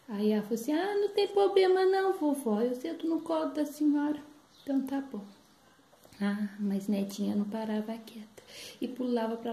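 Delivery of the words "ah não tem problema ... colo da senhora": granddaughter's voice. Acted. Granddaughter answers granny.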